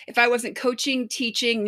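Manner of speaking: ordinary talk, nothing marked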